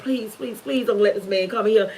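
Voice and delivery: low, very soft pleading; hushed, desperate begging, retold; retold tearful plea. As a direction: imitate a frightened plea kept quiet. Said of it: "and I'm crying. I'm like".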